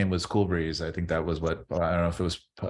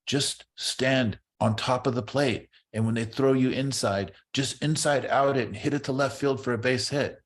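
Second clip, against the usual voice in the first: put-on Tony Oliva's accent; 7 semitones higher than usual. Coach Tony Oliva giving Marty hitting advice, in his accent. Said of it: "in his accent".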